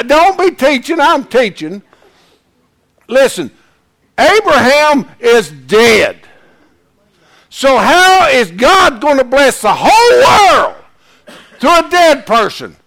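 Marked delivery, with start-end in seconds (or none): 4.2-12.8 loud, high, slow preaching shout. Real, not acted. Preacher shouting to congregation about Abraham, loud and slow. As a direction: hammer the point home from the pulpit.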